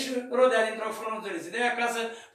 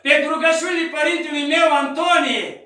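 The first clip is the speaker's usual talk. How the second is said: rugăminte tare, mai sus; roagă-te cu toată inima; rugă tare; 6 semitones higher, 8 dB louder than usual. Retold or imitated. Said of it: "Și zicea așa". (rugăciune tare și smerită)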